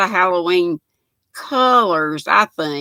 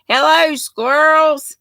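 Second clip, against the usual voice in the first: high, loud and drawn-out greeting to the squirrels; sing-song greeting; 8 semitones higher, 6 dB louder than usual. Retold or imitated. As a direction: call it out like a cheery auntie. Reading a viewer's cheery greeting.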